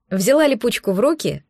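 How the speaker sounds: ordinary delivery, nothing marked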